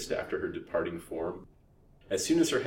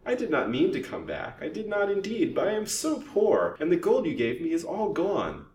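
put-on high, whining old crone's plea; piteous whine; 11 semitones higher, 4 dB louder than usual. A narrator's reading. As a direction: beg pitifully in a raised whine. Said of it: "whined the old crone piteously".

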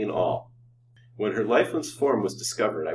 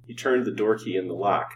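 ordinary delivery, nothing marked

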